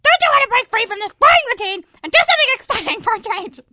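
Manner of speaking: loud, eager coaxing; eager urging. Acted. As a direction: egg him on loudly. Fly urges Flea loudly.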